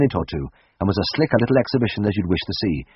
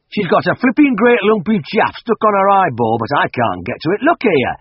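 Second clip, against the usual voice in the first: farmer voice; 11 semitones higher, 5 dB louder than usual. Acted. Farmer explains the eye.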